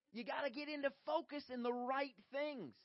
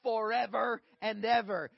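rising impassioned oratory; 2 semitones higher, 9 dB louder, slower than usual. Acted. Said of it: "He said".

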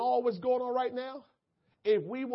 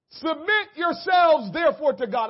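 preached scripture; 4 semitones higher, 5 dB louder than usual. Real, not acted. Preacher reads scripture aloud.

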